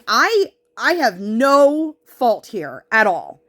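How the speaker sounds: slow, mocking quote of a smug denial; mocking coach. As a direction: drag it out like a smug excuse.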